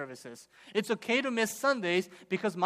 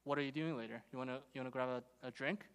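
low, soft flirty come-on to the waitress; sly quiet pickup line; husband flirting; 5 semitones lower, 9 dB quieter, faster than usual. Retold or imitated. Husband quietly flirting with the waitress, low and soft. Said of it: "and he says to her".

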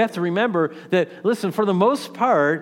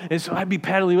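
ordinary talk, nothing marked

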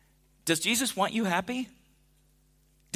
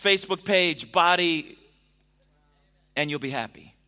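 louder, building preacher's list of worldly goals; rising sermon list; 4 dB louder, slower than usual. Acted. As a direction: pile up the list like a preacher gathering steam.

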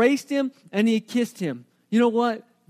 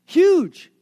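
much higher and louder single word Huge; emphatic shout; 7 semitones higher, 7 dB louder than usual. Real, not acted. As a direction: belt out Huge. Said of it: "This is huge".

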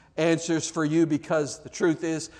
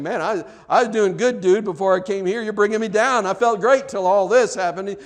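mock complaint; 5 semitones higher, 8 dB louder than usual. Mocking a listener's complaint.

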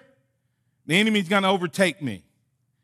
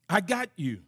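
reassuring; 4 semitones lower, 6 dB quieter than usual. Acted. God reassures Moses.

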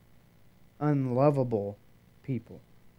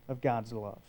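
normal talking voice

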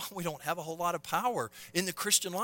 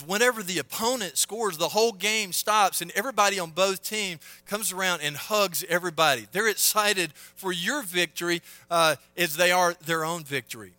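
loud, raised, excited pulpit telling; rousing preaching; 5 semitones higher, 8 dB louder than usual. Real, not acted. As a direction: build the story at full preaching volume. Preaches at full force.

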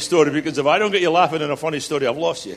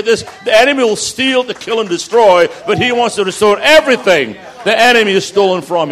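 loud, rising preacher's cry with hammered repeats; full-force preaching; 3 semitones higher, 6 dB louder than usual. Acted. Pastor preaching to the congregation, loud and climbing. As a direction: preach it out, building to a roar.

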